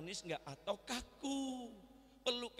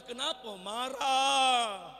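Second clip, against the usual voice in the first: shout; 4 semitones higher, 11 dB louder, much slower than usual. Acted. Preacher scolding angry fathers.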